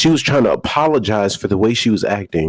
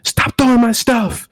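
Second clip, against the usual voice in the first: high, drawn-out mock shriek; high slowed girlfriend plea; 12 semitones higher, 3 dB louder, slower than usual. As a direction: imitate her slow high demand. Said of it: "quote of girlfriend".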